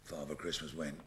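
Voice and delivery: ordinary talk, nothing marked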